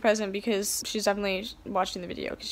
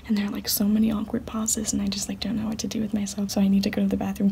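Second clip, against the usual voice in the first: rushed anxious whisper; whispering while hiding; faster than usual. Real, not acted. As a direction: whisper fast, keep hidden.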